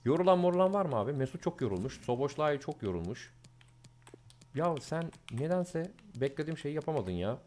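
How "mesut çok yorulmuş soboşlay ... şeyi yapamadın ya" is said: muttering to himself. Real, not acted. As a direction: mumble it half to yourself. Muttering while checking tired players.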